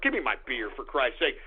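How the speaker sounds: ordinary talk, nothing marked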